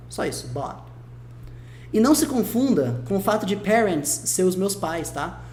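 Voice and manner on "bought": "bought" low and soft, T almost silent; dropped-T "bought"; very light T. Shown as a demonstration. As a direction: let the end of "bought" fade to "baw". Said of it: "o T fica tão leve".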